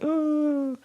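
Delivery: high, cutesy puppy voice for the dog; voicing the puppy. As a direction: speak for the little dog, high and cute. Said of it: "and he's like".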